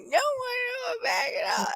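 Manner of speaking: very high-pitched, fast mock wail; imitated angry crying. Woman re-enacting her outburst at the checkout, mock angry crying. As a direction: squeal it like a tearful meltdown, played up. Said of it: "I'm, like, angry crying".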